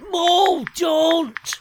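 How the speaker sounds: high urgent shout of don't; desperate plea. Acted. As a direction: beg him loudly to stop. Pleading against deleting show.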